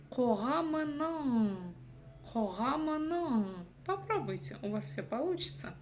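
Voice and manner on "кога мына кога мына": «кога мына» медленно, с казахским «н» в конце; тяни конец «мына», не касаясь языком нёба; «н» с хвостиком. Shown as a demonstration. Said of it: "мы услышим букву «н» с хвостиком". («кога мына» протяжно, со звуком «ң»)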